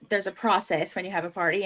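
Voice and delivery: ordinary talk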